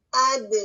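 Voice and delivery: اد with an echoing bounce on the d; bouncing dal. Shown as a demonstration. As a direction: let the d in اد bounce back. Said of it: "اس میں کیا ہو رہا ہے".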